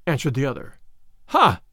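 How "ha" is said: raised cry of surprise; a short, higher "ha" of interest; narrated exclamation; exclaiming. A narrator's reading. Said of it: "cried Robin".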